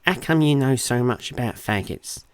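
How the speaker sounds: exaggerated Portsmouth accent, taunting; mock her with her own Portsmouth accent. A narrator's reading. Husband mocks his wife, mock Portsmouth accent. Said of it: "mocking her accent".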